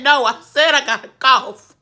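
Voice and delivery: exasperated insistence. Retold or imitated. Retold insisting to the doctor.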